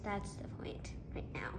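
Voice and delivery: quick whispered aside; whispering. Real, not acted. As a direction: whisper it like a secret. Secret aside while tasting.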